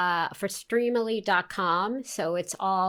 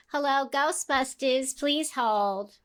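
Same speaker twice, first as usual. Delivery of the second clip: higher, nasal-free secretary phone greeting; Janine voice; 6 semitones higher, slower than usual. Retold or imitated. Actress recalls her one Janine line, in character voice. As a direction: answer the Ghostbusters phone as Janine, pitched up. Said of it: "where I just went".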